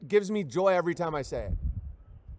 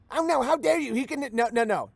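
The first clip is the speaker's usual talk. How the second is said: hammed-up shocked gasp, pitched much higher; mock outrage; 5 semitones higher than usual. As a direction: play the offended fan clutching pearls. Mock outrage at own joke.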